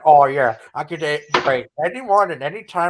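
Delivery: mock challenge, swooping upward; Ken Hovind. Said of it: "was saying, like, his voice thing".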